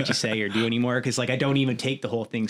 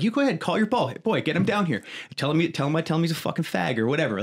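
taunting; 6 semitones higher than usual. Retold or imitated. Taunting stranger in bar.

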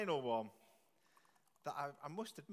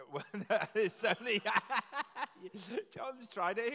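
laughing through the words; 5 semitones higher, 6 dB louder than usual. Real, not acted. Laughing while announcing.

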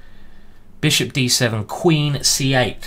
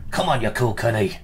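a mock Irish brogue, toned down; bad Irish accent. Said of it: "Very bad Irish accent there".

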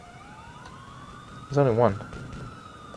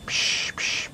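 two soft "psh" sounds; quiet mouth-made effect noises; imitated whoosh noises; 13 dB quieter than usual. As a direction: puff out two voiceless whooshes. Imitates noise while playing.

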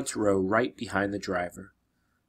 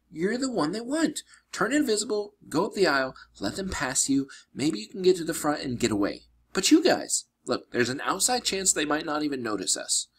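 much higher, urgent instructions; urgent girl's voice; 7 semitones higher than usual. Acted. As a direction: push him to act now.

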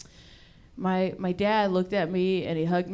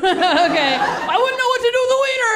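mock high voice; 12 semitones higher, 5 dB louder than usual. Mocking voice for punchline.

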